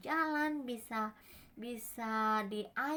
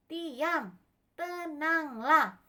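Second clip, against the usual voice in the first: raised, slow, falling call; loud, drawn-out order; commanding shout; 4 semitones higher, 6 dB louder, much slower than usual. Acted. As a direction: command calm with long syllables. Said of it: "Tuhan Yesusnya teriak".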